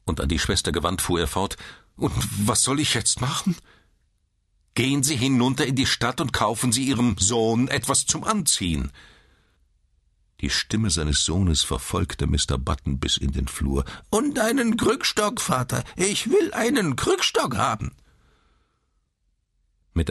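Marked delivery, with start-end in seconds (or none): 2.0-3.6 high, helpless question; flustered. A narrator's reading. Flustered father asks nurse. 4.7-8.9 high, commanding woman's voice; stern nurse. A narrator's reading. Stern nurse orders father. 14.1-17.9 put-on very high, screeching old man's call; demanding old man. A narrator's reading. Old man calls after father.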